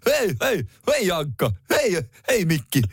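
Hessu. Pretend. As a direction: call out to pals like a goofy cartoon sidekick. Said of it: "Mä oon enemmän Hessu".

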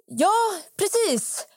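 high-pitched mimicked agreement; imitated eager man. Told to leave, he answers brightly anyway, imitated eager voice. Retelling the man's awkward answer.

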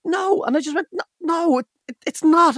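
high, clipped mock grumpy refusal. Host retelling shutting down the caller, high grumpy no. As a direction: snap no in a high, pinched voice. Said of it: "I said".

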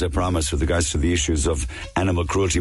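normal talking voice

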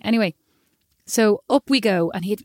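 ordinary talk, nothing marked

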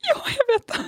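high, crying agreement; tearful admission. Retold or imitated. She admits her cruelty to him, tearful. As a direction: admit it through tears. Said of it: "Jeg bare".

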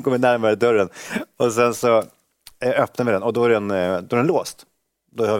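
ordinary talk, nothing marked

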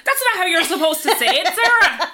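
high, loud, laughing reproach; laughing mock scold. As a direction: scold her through laughter. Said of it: "they were like".

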